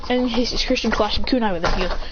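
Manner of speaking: plain reading voice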